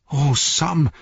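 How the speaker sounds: high, remorseful exclamation; crying out. A narrator's reading. Frodo to tearful Sam after accusing him, crying out. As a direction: call out his name in sudden remorse. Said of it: "rief Frodo".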